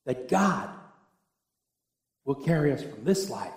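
usual speaking voice

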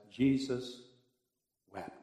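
soft, weighty "Jesus wept"; hushed reverent. Shown as a demonstration. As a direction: let it land gently. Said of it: "one of the most powerful verses".

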